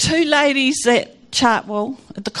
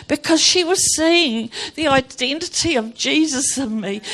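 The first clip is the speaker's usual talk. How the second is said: normal talking voice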